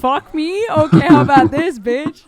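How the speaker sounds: loud, high, laughing taunt; mock threatening guard. Imagined American guard about to shoot, hammed-up laughing threat. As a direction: play the offended guard, amused.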